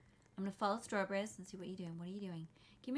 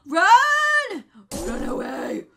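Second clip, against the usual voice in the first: screaming run, very high then breathy; panicked shout; 12 semitones higher, 20 dB louder, much slower than usual. Real, not acted. Warning friends as the monster appears, screaming. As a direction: yell a frantic warning.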